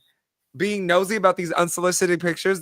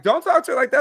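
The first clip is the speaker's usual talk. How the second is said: repeated much higher and louder, going in on him; louder callout; 11 semitones higher, 5 dB louder, faster than usual. Retold or imitated. Standing up for the woman in the wheelchair, a raised telling-off. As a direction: sharper second telling-off, pitched way up.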